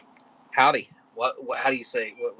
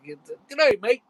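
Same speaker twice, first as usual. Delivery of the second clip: lightly attempted Australian greeting, raised and loud; Australian accent; 12 semitones higher, 6 dB louder than usual. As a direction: try a casual Australian accent. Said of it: "more of an Australian thing".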